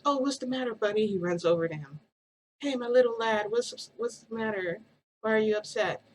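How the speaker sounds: put-on high cooing voice to a baby; baby talk. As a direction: coo at the little one. Friend comforts crying baby.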